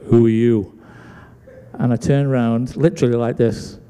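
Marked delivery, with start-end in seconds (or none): 0.1-0.8 low, firm imitation of a big man; deep voice. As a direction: drop low and loom over the listener. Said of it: "I hear his deep voice go".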